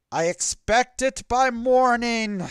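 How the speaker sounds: high, loud parting command; commanding call. Acted. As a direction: call it out as you take off. Dragon commands while leaving.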